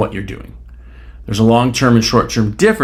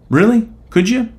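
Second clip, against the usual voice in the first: sarcastic disbelief; 8 semitones higher than usual. Real, not acted. Mocking an unrealistic claim.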